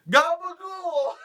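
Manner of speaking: high, drawn-out mock holler; mocking dialect shout. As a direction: ridicule the word in a long high cry.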